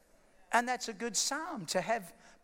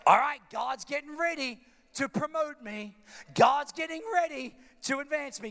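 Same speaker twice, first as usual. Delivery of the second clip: a louder preacher's declaration of faith; declaring preacher; 5 semitones higher, 5 dB louder than usual. Acted. Preacher tells the church to speak faith, louder declaration. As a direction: proclaim it to the congregation.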